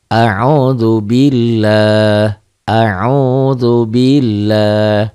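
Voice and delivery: slow recitation, lah of billah thin like A; thin Allah. Shown as a demonstration. Teacher demonstrating thin Allah, slow chant. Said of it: "ini berbunyi A".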